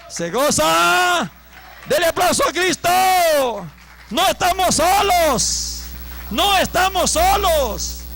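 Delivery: high, very loud stretched proclamation; full-force preaching. Real, not acted. Preacher telling congregation they are not alone, full-force preaching. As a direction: shout it out to rally the congregation.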